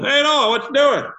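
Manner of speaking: high jeering call; mocking neighbour. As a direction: heckle him in a sing-song way up high. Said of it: "people going".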